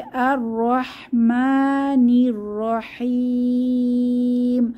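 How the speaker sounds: very slow chanted verse; slow tajweed reading; Quran recitation. Shown as a demonstration. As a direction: hold each long vowel. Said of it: "তাহলে".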